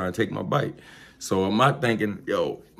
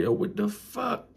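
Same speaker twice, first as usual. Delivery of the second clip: baffled; 13 semitones higher, 5 dB quieter than usual. Retold or imitated. Baffled by mother's reversal.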